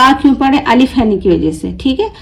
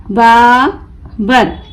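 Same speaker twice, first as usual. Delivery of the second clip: Babar very slow, long बा, pause, बर; broken into syllables; much slower than usual. Shown as a demonstration. Teacher breaking the word for learners, Babar in slow pieces. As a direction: pull Babar apart into बा and बर. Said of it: "तोड़ तोड़ कर पढ़ूंगी".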